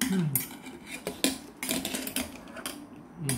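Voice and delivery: muffled closed-mouth hum; eating while humming; mouth full, chewing. Real, not acted. Seen 1: Bit fish cake before; lips closed, chewing through the labelled hums.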